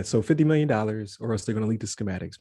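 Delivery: usual speaking voice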